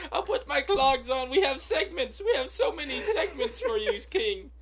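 high, hammed-up fawning peasant; sarcastic groveling. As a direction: grovel to the king, laughing. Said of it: "you're like".

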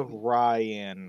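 RYAN stretched into "Rye... an"; said slowly. Shown as a demonstration. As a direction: draw Ryan out slowly, syllable by syllable. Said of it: "Do I need to say it slow?".

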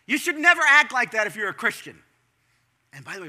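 ordinary talk, nothing marked

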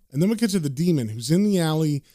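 plain reading voice

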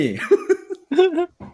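a high squealing laugh. Real, not acted. Friend cracks up high-pitched over the film scene. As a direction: let a shrill-free high giggle burst out.

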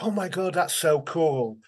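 lightly voiced kids in awe; imitated impressed kids. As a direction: marvel like an impressed schoolchild. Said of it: "And they're like".

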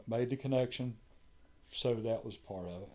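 ordinary talk

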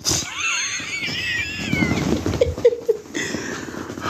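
long high-pitched cackle; high laughing outburst. Real, not acted. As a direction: burst out in a high, drawn-out laugh. Cracking up at own riff.

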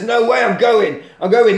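acted agitated outburst; mock protest. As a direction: dig in and protest loudly. Acted refusal by upset cop.

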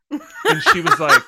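giggling through the setup; laughing. Real, not acted. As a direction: laugh while telling it. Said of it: "I remember you told me this".

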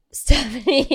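put-on name said in a light Spanish accent. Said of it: "that was my Spanish accent".

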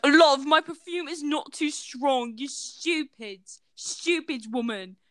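put-on slow, drawn-out British chav girl; exaggerated British accent, drawled; mock British accent. British Hot Cheeto girl to woman, mock British accent. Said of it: "They're the British equivalent of Hot Cheeto girls".

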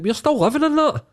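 as if stunned by the obvious; incredulous. Retold or imitated. Imagining people looking back at today, in high-pitched disbelief. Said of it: "You're like".

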